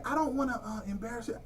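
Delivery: lightly voiced tactful mother, fading out; mock polite lady. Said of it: "She said".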